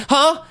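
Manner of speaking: "Huh?" pitched very high, like a question. Retold or imitated. Frightened woman ending her plea, high questioning "Huh?". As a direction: tack on a high, querying "Huh?" in the old woman's voice. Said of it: "Like a question".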